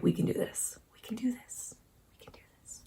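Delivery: fading away to a whisper; hushed self-encouragement, trailing into near silence; muttered pep talk. Real, not acted. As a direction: psych yourself up under your breath.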